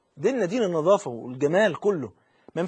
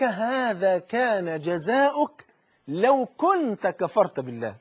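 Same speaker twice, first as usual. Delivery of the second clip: grave slow warning; 2 semitones higher, much slower than usual. Acted. Voice in the grave warning of the fire, very slow and grave. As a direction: warn with heavy deliberate slowness.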